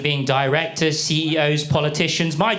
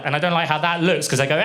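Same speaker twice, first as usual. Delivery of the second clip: ordinary talk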